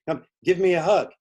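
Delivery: loud, high call for a hug; eager welcome. Retold or imitated. Staff welcoming him with hug.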